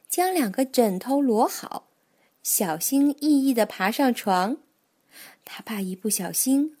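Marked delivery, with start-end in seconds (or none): none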